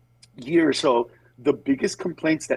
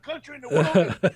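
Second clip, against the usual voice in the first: higher and faster, mock-offended American; indignant American; 7 semitones higher than usual. Comic imitating an offended patriot with a higher, faster voice. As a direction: bristle like a guy defending his country. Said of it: "They'll say".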